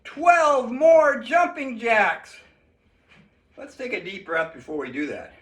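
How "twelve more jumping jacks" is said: drawn-out announcement. Acted. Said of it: "Can you believe it?".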